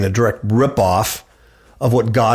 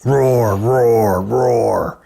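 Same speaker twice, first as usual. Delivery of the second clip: ordinary delivery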